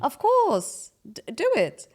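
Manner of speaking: high-pitched mock motherly urging; encouraging mom. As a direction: urge it on eagerly, pitched well up. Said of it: "Her mom would be like".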